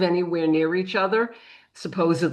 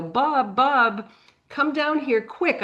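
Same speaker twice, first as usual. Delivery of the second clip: higher, half-hearted mock yell; retold yell; 6 semitones higher, slower than usual. Woman calls her husband down the aisle, higher mild mock yell. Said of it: "she yells down the aisle".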